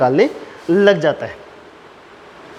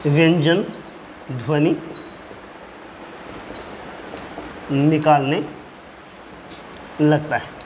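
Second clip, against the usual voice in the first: single words spaced by long pauses; slow dictation; 6 dB quieter, slower than usual. Real, not acted. Teacher dictating the consonant-sound point, word-by-word dictation. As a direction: give each word separately for writing down.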